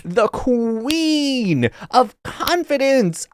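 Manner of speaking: high, slow, doting hype voice; shower her with gushy praise; gushing classmates. Retold or imitated. Classmates hugging the daughter, high and gushing. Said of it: "everyone's like".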